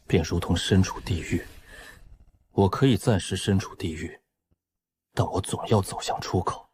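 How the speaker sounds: measured, subdued resolve; hushed musing. Acted. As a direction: think it aloud quietly, with pauses. Musing to himself inside a dream.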